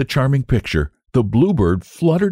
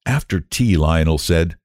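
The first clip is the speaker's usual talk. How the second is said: plain reading voice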